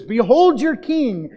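slow, loud, sarcastic announcement; mocking proclamation. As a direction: present the king with a sneer, drawn out. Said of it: "Pilate is doing is sarcastic".

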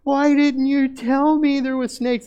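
high, loud, slow tearful yell; a child yelling through tears; retold crying yell. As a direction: wail it like a scared child. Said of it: "He started yelling at us".

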